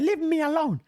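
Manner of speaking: high-pitched sulky rant; whiny upset crypto fan. Retold or imitated. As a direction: whine like a sulky kid who lost money.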